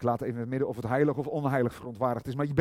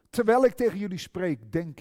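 much higher and louder, bursting out; fervent cry; 8 semitones higher, 8 dB louder than usual. Acted. Preacher voicing God to the congregation, fervent cry. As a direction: cry it out with full fervour. Preacher exclaims God's words.

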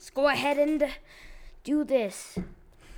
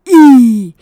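shriek; 24 dB louder than usual. Real, not acted. Shocked at wasted sandwich.

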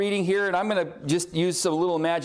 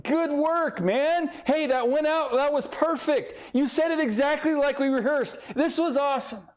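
high, gleeful back-slapping voice; gloating buddies; 9 semitones higher than usual. Retold or imitated. Sadducees congratulating their questioner, gleeful and high. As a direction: crow over the win, pitched well up. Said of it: "like, elbowing".

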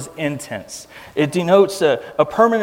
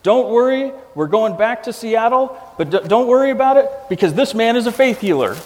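imitating a yell; 8 semitones higher than usual. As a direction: play the pastor friend shouting playfully at strangers. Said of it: "he starts yelling to random strangers".

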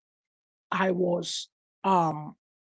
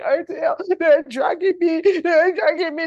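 higher, louder, slow panicked wail; wife crying out from a nightmare in terror; terrified cry; 10 semitones higher, 11 dB louder than usual. Retold or imitated. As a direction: cry out as if being dragged away.

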